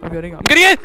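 high, very loud burst of panic; panicked yell. Real, not acted. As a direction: shout it while fleeing in fright. Fleeing into hiding.

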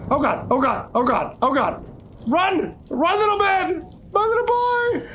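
high panicked shouts; panicked yelling. Real, not acted. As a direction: yell in a rising panic. Panic as enemy chases.

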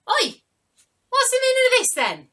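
high, loud, outraged cry; indignant pigeon. Acted. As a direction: shriek it like a furious little bird. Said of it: "you hear a voice cry out".

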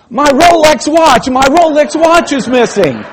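high, loud panicked cry with a laugh; hammed-up horror over a missing watch; alarmed cry. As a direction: yelp it out in sudden panic. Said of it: "looked down and he said".

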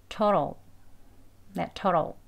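"turtle" short, soft flap and light L; weak conversational pronunciation. Shown as a demonstration. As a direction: reduce "turtle" to a quick "tur-ro". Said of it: "이렇게 약하게 하면".

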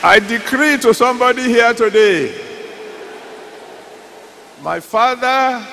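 loud, high preaching decree. Acted. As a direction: proclaim it with full force. Decree over the congregation.

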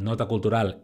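normal talking voice

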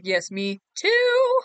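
loud, high, drawn-out "too"; high, loud and slow enthusiasm; emphatic agreement. Real, not acted. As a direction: agree with a big stretched shout. Heartily agreeing with a chatter.

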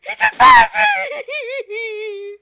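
slow high frightened wail; crying out in fear. Real, not acted. Killer walks right past her.

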